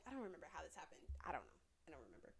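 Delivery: hushed unsure admission; real whisper, unsure; whispered aside. Real, not acted. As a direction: whisper it, half to yourself. Struggling to recall.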